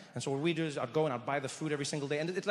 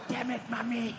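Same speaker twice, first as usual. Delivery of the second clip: mouthing along; 5 semitones higher than usual. Retold or imitated. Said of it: "I'd mouth it next to him".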